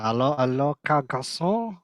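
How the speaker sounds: slow, hammed-up French accent. Reading a French listener's message on request, hammed-up French accent. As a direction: put on a drawn-out French accent, pitch climbing. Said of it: "I wanna see your French accent".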